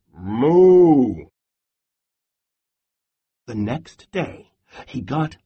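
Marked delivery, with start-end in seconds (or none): 0.1-1.3 loud drawn-out cow moo. Acted. Cows mooing in the background, loud and drawn out.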